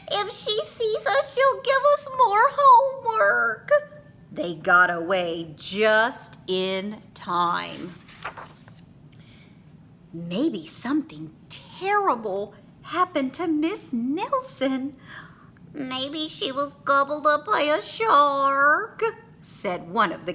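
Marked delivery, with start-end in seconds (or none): 0.1-3.7 put-on very high, urgent frightened child voice; scared kids. A narrator's reading. Kids fleeing the witch teacher, scared child voice. 10.1-19.0 put-on higher child voice; worried kids. A narrator's reading. Kids guessing teacher's fate, worried child voice.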